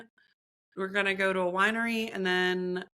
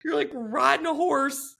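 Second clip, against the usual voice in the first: higher, incredulous complaint; exasperated disbelief; 9 semitones higher than usual. Retold or imitated. Retelling her exit to friend, higher and exasperated. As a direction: sound done with the whole scene. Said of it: "I was like".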